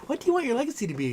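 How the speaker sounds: put-on high, quick little-girl voice; quick mock child's question. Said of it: "The little girl's like".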